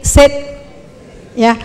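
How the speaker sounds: normal talking voice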